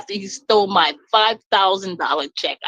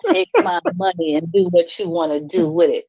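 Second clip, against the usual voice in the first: ordinary delivery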